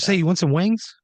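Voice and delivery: high, loud salesman's pitch; sudden high, loud invitation; upbeat offer. Retold or imitated. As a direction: pipe up like a chummy host. Acts out host's eager offer.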